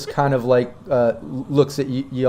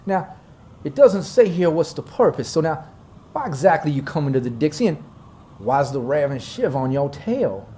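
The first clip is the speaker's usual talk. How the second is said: light Southern drawl, probing; a suspicious colonel questioning city folk; Dixie drawl; 2 semitones higher than usual. Acted. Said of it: "my southern drawl".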